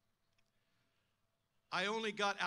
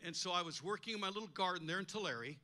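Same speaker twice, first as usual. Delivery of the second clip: ordinary delivery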